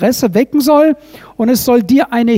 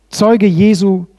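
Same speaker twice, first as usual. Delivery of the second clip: normal talking voice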